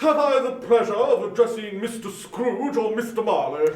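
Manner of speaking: lightly voiced charity gentleman; polite portly gentleman. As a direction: greet him with formal politeness. Gentleman continues.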